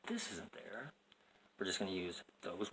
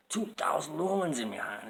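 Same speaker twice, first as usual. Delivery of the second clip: put-on British accent; 7 semitones higher, 8 dB louder than usual. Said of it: "it kinda went over to British there".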